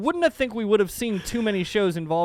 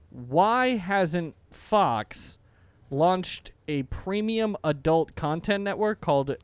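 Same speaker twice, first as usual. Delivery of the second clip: slow deadpan; 3 semitones lower, much slower than usual. Acted. Slow joke build-up.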